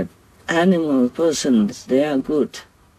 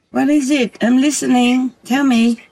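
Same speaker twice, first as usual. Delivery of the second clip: high, louder coaxing call; 10 semitones higher, 4 dB louder than usual. Real, not acted. Master calling to the fox to speak, high and louder. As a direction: call out gently to draw the fox near.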